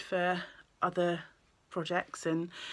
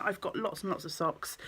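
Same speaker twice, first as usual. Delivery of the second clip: normal talking voice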